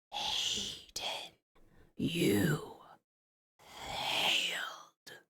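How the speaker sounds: soft breathy ghost voice; ghostly whisper. Acted. Ghost brother accusing Anaconda, soft whisper. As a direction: hiss the accusation softly. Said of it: "Cobra responds".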